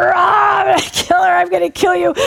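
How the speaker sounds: high shouted growl, imitating a devil; demon threat. Devil in Irene threatens the ministers, shouted mock growl. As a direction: roar like an enraged demon. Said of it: "And it's going".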